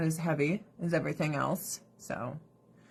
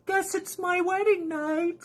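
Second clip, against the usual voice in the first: put-on much higher, slow little-girl voice; imitating child bride; 10 semitones higher, slower than usual. Child bride voiced by narrator, high slow small voice. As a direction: speak slowly like a small resigned girl. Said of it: "And she's like".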